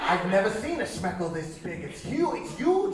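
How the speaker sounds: put-on amazed Yiddish accent climbing very high. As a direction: build to high-pitched awe, Yiddish accent. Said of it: "forgive my terrible Yiddish accent".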